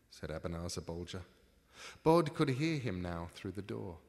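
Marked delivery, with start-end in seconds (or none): none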